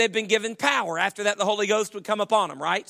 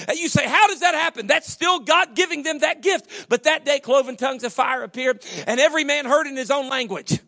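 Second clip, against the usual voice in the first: higher, louder preaching build; 5 semitones higher, 4 dB louder than usual. Real, not acted. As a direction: preach it with rising fervor.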